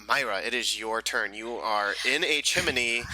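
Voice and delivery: ordinary delivery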